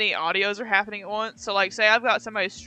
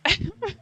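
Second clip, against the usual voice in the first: high squealing laugh; 11 semitones higher than usual. Real, not acted. Laughing at own story.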